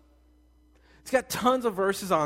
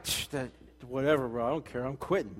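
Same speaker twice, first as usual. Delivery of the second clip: dismissive guy; 7 semitones lower, 6 dB quieter than usual. Retold or imitated. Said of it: "we're like".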